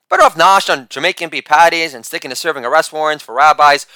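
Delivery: normal talking voice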